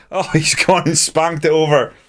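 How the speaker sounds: laughing in disbelief, still high; rueful laugh. Real, not acted. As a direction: laugh it off after the miss. Chance missed, laughs.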